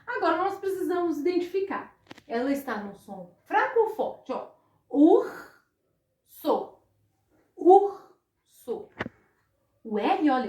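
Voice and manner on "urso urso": urso with the R pushed hard, rising in pitch and volume; strong R in urso. Shown as a demonstration. Teacher to pupils, urso with a strong R. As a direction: lean on the R in urso. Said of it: "eu escutei ele bem forte".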